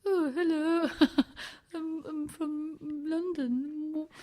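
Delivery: high, loud, slow hammed-up English lady; posh English act. To the tutor asking who she is, hammed-up high English greeting. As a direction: lay the English charm on thick, very slowly. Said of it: "And I went".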